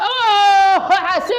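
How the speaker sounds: high, loud cry of alarm; sudden panicked scream; panicked shout. Acted. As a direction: yell to warn of danger. Panicked yelling during snake scene.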